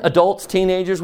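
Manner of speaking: ordinary talk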